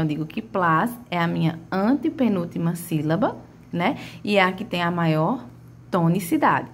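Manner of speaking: ordinary talk, nothing marked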